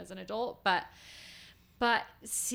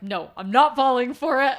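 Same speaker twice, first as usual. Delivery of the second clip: mock defiant outburst; 5 semitones higher, 14 dB louder than usual. Defiant self-quote about kids growing.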